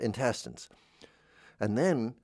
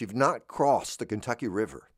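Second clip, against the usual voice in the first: usual speaking voice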